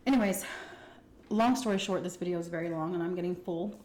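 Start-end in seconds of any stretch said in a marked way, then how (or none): none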